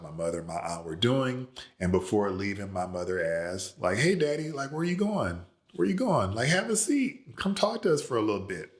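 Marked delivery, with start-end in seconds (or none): 4.0-8.7 much higher, sing-song coaxing voice; playful high teen voice luring her father to sit; sweet-talking daughter. Retold or imitated. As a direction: coax Daddy over in a high girlish voice.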